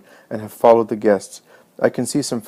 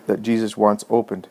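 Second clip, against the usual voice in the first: ordinary delivery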